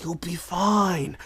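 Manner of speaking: lightly voiced calm Keanu; Keanu Reeves. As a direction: soothe Johnny like a laid-back buddy. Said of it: "I'm doing Keanu".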